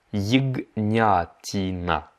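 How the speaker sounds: ягнятина said very slowly, every syllable clear; slow teaching repeat. Shown as a demonstration. Teacher slowing ягнятина down for learners. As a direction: stretch ягнятина out. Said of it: "slow repeat of vocabulary".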